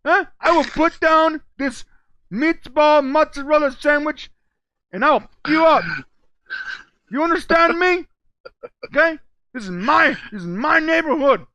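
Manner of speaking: put-on high, loud, clumsy New York mobster accent; bad mob accent. Cohost voicing Wise Guy in a bad mob accent, loud. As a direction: throw out tough mob-guy threats in a wobbly accent. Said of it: "I'm really bad at accents".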